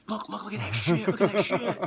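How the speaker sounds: laughing look look look. Retold or imitated. Wrestlers showing Flair the sign, laughing. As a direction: giggle while pointing it out. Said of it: "tap him on the shoulder and went".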